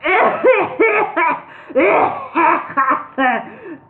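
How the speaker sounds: high loud hacking laugh; laughing coughing fit. Acted. Wizard shakes with a loud high laughing cough. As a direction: laugh and cough till shaking. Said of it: "The wizard continued".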